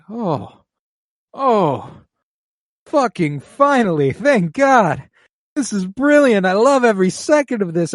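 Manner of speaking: loud, high-pitched rejoicing; retold burst of overjoyed relief; elated relief. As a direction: exclaim it like a fan finally getting their wish. Acts out own relieved viewer reaction.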